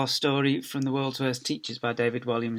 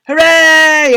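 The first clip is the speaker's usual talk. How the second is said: high, drawn-out crowd cheer; loud cheer; 17 dB louder than usual. A narrator's reading. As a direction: cheer like a whole school. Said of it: "everyone cheered".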